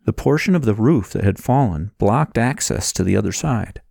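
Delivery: usual speaking voice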